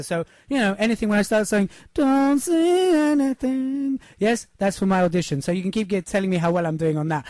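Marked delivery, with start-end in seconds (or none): 1.9-4.0 slow, drawn out mock talent show judge; mock X Factor judge. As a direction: ham up a Simon Cowell catchphrase. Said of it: "I'm working my audition for X Factor".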